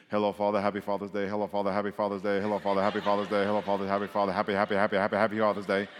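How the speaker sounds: low, rapid monotone chant; mindless repetition. Pretend. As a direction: rattle it off on one low note like a robot, mock-rote. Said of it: "over and over again a lot of times".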